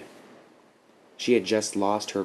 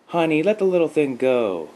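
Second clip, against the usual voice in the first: high, raised motherly call; mom calling out; 8 semitones higher, 6 dB louder than usual. A narrator's reading. Mother tells her daughter to release the toad, calling out. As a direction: call across the yard to your child. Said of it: "called out Nikki's mom".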